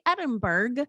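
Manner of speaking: "Edinburgh" as ED-in-berg, hard G ending; American mistaken "Edinburgh", ending in -burg; mispronounced American way. Retold or imitated. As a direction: say "Edinburgh" wrongly to rhyme with iceberg. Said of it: "smart enough not to say".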